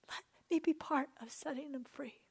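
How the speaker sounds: whispered prayer, breath only. Real, not acted. Preacher praying to God for freedom, whispering. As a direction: hush it to God, barely a breath.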